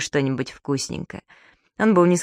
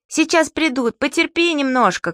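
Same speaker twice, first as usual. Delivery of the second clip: high, urgent encouraging call; shouting up; 8 semitones higher than usual. Acted. Субастик shouts up to his stuck father, urgent encouragement. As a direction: hold him on, shouting. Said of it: "крикнул он господину Пепперминту".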